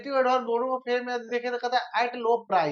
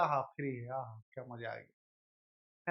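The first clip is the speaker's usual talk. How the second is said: softer, slow, dreamy delight at something free; 9 semitones lower, 11 dB quieter, slower than usual. Pretend. Teacher mimicking a person thrilled by a free offer, soft and slow. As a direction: savour it like getting a freebie. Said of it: "उसको कहते हैं".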